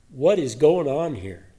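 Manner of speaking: ordinary delivery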